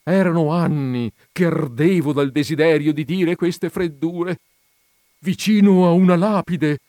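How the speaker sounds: high, loud, gleeful outburst; excited declaration. A narrator's reading. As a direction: declare a long-held burning wish. Joker declares his long-held desire. (slancio appassionato, voce alta)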